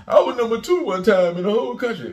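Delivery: high, loud mock protest; mocking a boast. Mocking Gillie's boast as a quote.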